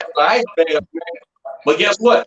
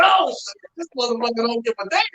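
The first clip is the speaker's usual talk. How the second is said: retold excited outburst, a high shout that speeds up; hammed-up awe at a fearless fighter; amazed exclamation; 6 semitones higher, faster than usual. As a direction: blurt it out high, half-shouting in awe, then rattle on fast. Retelling his own amazed reaction.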